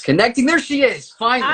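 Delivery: loud, high burst of excitement; excited relief. Real, not acted. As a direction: rise into a delighted shout as the guest appears. Guest finally connects to livestream.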